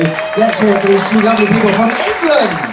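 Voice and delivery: loud, high crowd-rousing cheer; rousing introduction. Real, not acted. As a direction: build to a big stretched-out announcement. Host whips up audience applause.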